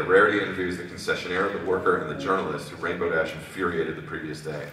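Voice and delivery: plain reading voice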